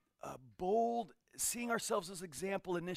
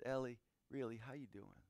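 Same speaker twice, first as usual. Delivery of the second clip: gentle caring question; 4 semitones lower, 7 dB quieter than usual. Retold or imitated. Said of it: "touched Ellie's hand and she said".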